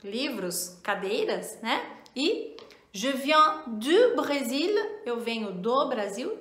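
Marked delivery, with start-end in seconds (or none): 2.9-4.7 slow careful French review phrases; slow French model sentences; review examples. Shown as a demonstration. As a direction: read the examples out clearly. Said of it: "frases de exemplo".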